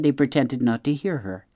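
plain reading voice

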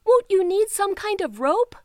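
high, loud call upward; hollered question. Acted. Teeny hollering up to her climbing brothers, high and loud. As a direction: call up to them as they climb. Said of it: "their sister hollered".

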